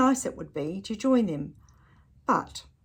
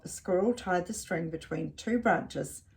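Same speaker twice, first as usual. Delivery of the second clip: ordinary delivery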